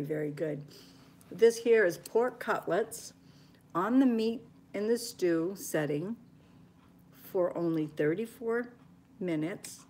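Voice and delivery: usual speaking voice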